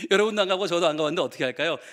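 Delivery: normal talking voice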